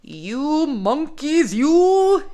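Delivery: slow, high scolding, loud on YOU; scolding peddler. A narrator's reading. Peddler to the monkeys in the tree, scolding. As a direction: scold them with drawn-out words. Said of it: "shaking a finger at them".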